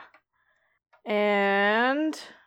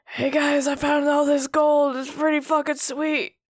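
cyber demon voice; 5 semitones higher than usual. Acted.

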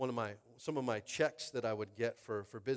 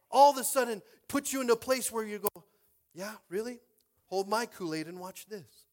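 defiant preaching; 6 semitones higher, 9 dB louder than usual. Acted. Preacher daring his doubters, loud and defiant. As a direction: toss off the challenge with swagger.

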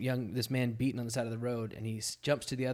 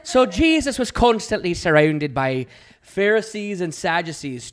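louder, higher hammed-up Irish accent; mock Irish accent; 4 semitones higher, 10 dB louder than usual. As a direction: tell it like a playful Irish storyteller. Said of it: "Let's just do this in Irish".

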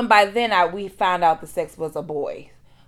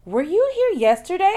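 much higher and louder, a brisk questioning nurse; high-pitched nurse; 13 semitones higher, 5 dB louder than usual. Retold or imitated. Nurse asks the patient, high and brisk. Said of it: "and she said".

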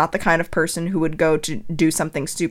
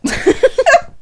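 short high burst of laughter; 3 dB louder than usual. Real, not acted. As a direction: laugh at yourself. Laughing at herself.

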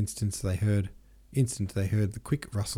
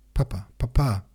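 raised, higher pleading call; sobbing call; 4 semitones higher, 5 dB louder than usual. A narrator's reading. Son calls after his departing father, sobbing call. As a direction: call after him, mildly tearful. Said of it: "he called after him, sobbing".

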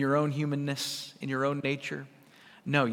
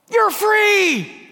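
much higher, louder gleeful yell; a hammed-up cry to a room of toddlers; mock shout; 8 dB louder than usual. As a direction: fling the door open and holler it. Opening kindergarten door, shouting.

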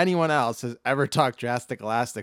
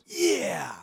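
toy commercial yeah; 6 semitones higher than usual. Shown as a demonstration. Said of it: "That was more like".

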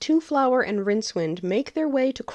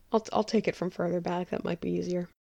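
usual speaking voice

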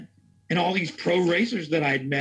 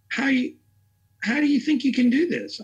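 incredulous question; 9 semitones higher than usual. Retold or imitated. Directors doubting him.

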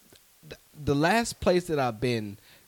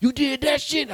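put-on pitched up, pushy old man urging him on; hammed-up older man, higher and louder; older gentleman; 7 dB louder than usual. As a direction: lean in like an insistent older guy hyping a young comic. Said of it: "I was like".

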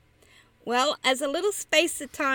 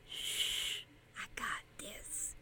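whisper; 19 dB quieter, slower than usual. Acted. Woman's repeated whispered refrain.

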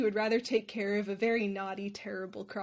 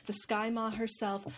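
ordinary talk, nothing marked

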